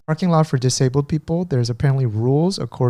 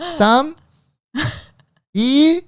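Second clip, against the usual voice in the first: high, halting, louder digits; 13 semitones higher, 4 dB louder than usual. Retold or imitated. Caller stumbling over the digits, high and halting. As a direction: hesitate over each number. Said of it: "I'll be like".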